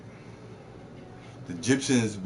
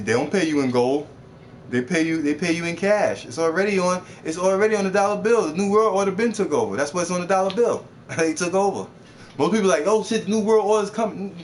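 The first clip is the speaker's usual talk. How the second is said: louder, higher, worked-up insistence; heated rant; 7 semitones higher, 4 dB louder than usual. Real, not acted. Explaining the New World Order to chat, excited and loud. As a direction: hammer the point home.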